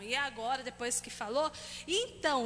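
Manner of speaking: normal talking voice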